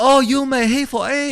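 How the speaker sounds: very high, loud mock Chinese accent. Shown as a demonstration. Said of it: "Meanwhile, the Chinese say".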